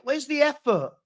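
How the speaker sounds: usual speaking voice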